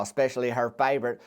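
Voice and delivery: usual speaking voice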